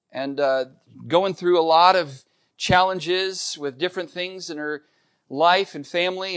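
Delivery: normal talking voice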